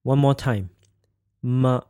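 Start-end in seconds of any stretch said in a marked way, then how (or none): 1.4-1.8 M as a short, toneless "muh"; neutral tone. Shown as a demonstration. Teacher demonstrates the fifth tone, short and toneless. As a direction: say M light and clipped short, mid pitch. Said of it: "toneless and shortened".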